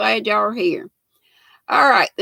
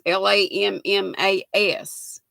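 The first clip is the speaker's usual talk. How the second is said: normal talking voice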